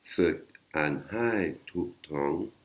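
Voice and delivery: Fek An Hai Tuk Tong très lent, chaque syllabe posée; titre thaï lent. Shown as a demonstration. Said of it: "Alors, Fek, c'est s'entraîner". (titre thaï prononcé lentement pour les élèves)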